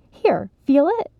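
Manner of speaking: eager invitation; excited. Acted. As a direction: come on, feel this. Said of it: "with excitement".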